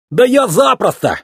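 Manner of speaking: high-pitched keen character voice; eager volunteer. A narrator's reading. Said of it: "вызвался мэтр".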